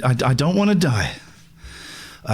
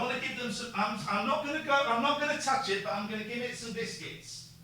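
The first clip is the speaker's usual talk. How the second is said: hushed aside; 9 semitones higher, 14 dB quieter than usual. Real, not acted. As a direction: quietly tell listeners the plan. Hushed aside while near animal.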